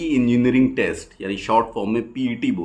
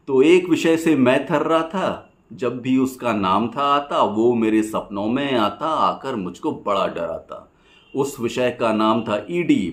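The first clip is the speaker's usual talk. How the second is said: rhythmic rhymed verse, pausing after each line; poem recitation; 4 semitones higher, 3 dB louder than usual. Acted. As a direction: recite it as lilting stage poetry.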